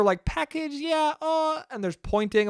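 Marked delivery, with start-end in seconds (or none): 0.2-1.6 high put-on old-lady mumble; mock elderly voice, much higher; old woman overheard. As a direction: imitate snatches of an old woman's talk, high and halting. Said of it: "I just hear".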